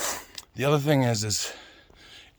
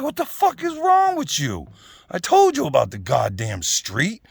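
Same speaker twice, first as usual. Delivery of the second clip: angry parent scolding; 4 semitones higher than usual. Retold or imitated. Parent to kid who ran into the street, acted furious scolding. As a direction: play an exasperated parent yelling.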